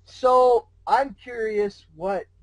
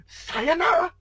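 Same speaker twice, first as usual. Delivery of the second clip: lightly voiced high goodbye cry; a playful cheerful farewell shout; cried out; 10 semitones higher, slower than usual. Discord kicking the hero away, a playful high Sayonara. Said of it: "he cried".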